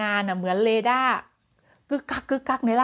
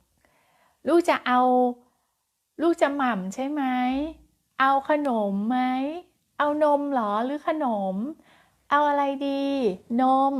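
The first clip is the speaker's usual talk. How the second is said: slow, higher, patient questions with pauses; slow coaxing mother; 4 semitones higher, slower than usual. Shown as a demonstration. Teacher slowing down to show patient coaxing, slow and pausing. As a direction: wait after each question. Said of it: "ครูแหม่มก็จะเร็วไปหน่อยเนาะ".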